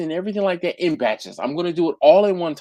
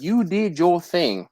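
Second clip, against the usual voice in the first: quoted praise; slower than usual. Retold or imitated. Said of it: "She was like".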